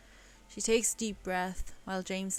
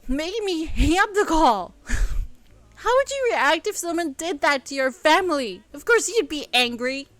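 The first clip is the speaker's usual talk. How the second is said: higher, louder impassioned defence; heated outburst; 6 semitones higher, 9 dB louder than usual. Acted. Elle justifying her anger to James, heated. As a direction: defend her mother fiercely.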